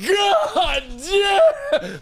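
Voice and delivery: high-pitched, laughing yell; amazed mock shout. Playing smokers blown away by bone dust, amazed shout.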